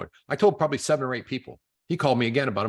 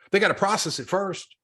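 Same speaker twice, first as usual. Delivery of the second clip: ordinary talk, nothing marked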